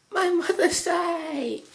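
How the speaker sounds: high, slow, joyful little creature voice; a child cheering its new mother; gleeful cheer. A narrator's reading. Changeling snuggling his new mother, high gleeful cheer. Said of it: "Dragonfly cheered gleefully".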